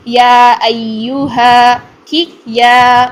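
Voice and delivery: یا ایوہا drawn out slowly and loud; slow recitation demo; Quranic example recited. Shown as a demonstration. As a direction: recite یا ایوہا with long vowels. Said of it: "examples".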